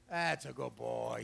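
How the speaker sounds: low, slow and soft to the dog; soft praise. Real, not acted. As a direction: soothe the dog gently.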